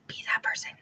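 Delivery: soft unvoiced whisper; conspiratorial whisper to the viewer; whispered advice. Real, not acted. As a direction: lean in and whisper the tip. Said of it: "urging new consultants".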